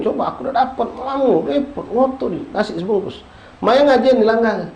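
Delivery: high, eager, laughing voices of people rushing for rice; excited grabbing crowd. Acted. Preacher mimicking greedy people at the rice handout, high and eager. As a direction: play the pushy congregants grabbing food, chuckling.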